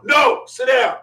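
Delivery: loud, high mock-sergeant shout; retold yelling. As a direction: shout down a timid request, imitating. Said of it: "Now she's yelling at the mother".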